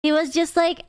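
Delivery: ordinary talk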